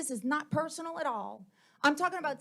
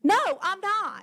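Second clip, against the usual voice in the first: louder and higher, slow and firm: no, I'm not; heated refusal; 5 semitones higher, 7 dB louder than usual. Real, not acted. Board member refusing the chair's cutoff, heated and loud. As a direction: refuse to be cut off, dig in.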